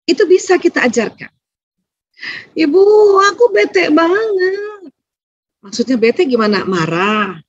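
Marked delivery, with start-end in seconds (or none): 2.6-4.9 put-on high, slow whiny kid voice; sulky child. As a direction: drag it out like a sulking child. Child complaining to mother.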